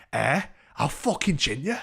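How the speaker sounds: mock threat, lifted voice; threatening drunk woman. Woman behind the door threatening him, belligerent. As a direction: imitate her belligerent challenge.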